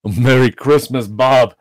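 loud, slow, heartfelt greeting; hearty earnestness. A narrator's reading. Scrooge claps Bob on the back, hearty earnestness. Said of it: "said Scrooge with an earnestness that could not be mistaken".

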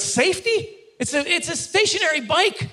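high-pitched comic disbelief; mock incredulous. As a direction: protest the absurdity of it. Incredulous rant about safety inspector.

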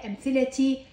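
أمثلتي said amthilati, final ti; voweled t ending. Shown as a demonstration. Teacher showing the spoken t, أمثلتي ending in ti. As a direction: end أمثلتي on a clear ti. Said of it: "هنا لفظتها تاء".

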